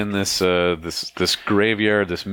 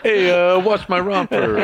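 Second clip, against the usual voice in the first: high, loud Brooklyn guy; hammed-up Brooklyn hustler; brooklyn accent; 12 semitones higher, 4 dB louder than usual. GM voices a Brooklyn NPC.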